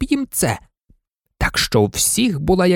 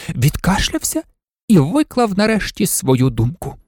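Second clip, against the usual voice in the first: ordinary delivery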